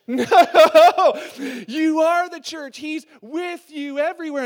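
hammed-up loud, high cry of protest; exasperated correction. Preacher as Paul corrects the clueless Corinthians, exasperated shouting.

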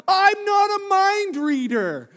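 slow, high, loud protest, each word dragged out; exasperated shout. Retold or imitated. Husband protesting to his wife, slow exasperated shout. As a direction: shout it slowly at her. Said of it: "I'm like".

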